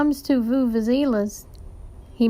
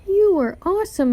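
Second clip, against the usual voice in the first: high, loud burst of praise; excited praise; 8 semitones higher, 4 dB louder than usual. A narrator's reading. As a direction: cheer him on with delight. Kid cheering the teacher.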